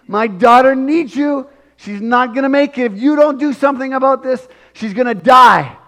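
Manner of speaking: a father on his knees, pleading for his dying daughter; desperate pleading. Retold or imitated. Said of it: "He was holding on for dear life, saying".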